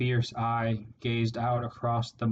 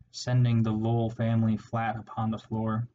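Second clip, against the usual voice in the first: ordinary delivery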